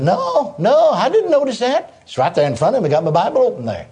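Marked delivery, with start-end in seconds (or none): 0.0-2.0 high, drawn-out retold disbelief; out-loud surprised no. Preacher answering the inner voice, retold astonished denial. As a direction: answer aloud with slow, rising astonishment. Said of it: "I out loud said".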